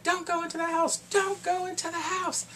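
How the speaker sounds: high urgent warning cry; Cassidy warning Dennis, high urgent call. Retold or imitated. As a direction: yell it up high to stop him.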